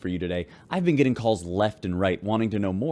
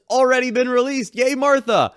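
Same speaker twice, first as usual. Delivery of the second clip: much higher and louder, a bright cheer; excited cheer; 7 dB louder than usual. Acted. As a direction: whoop it out for Martha. Host cheering Martha's quick release.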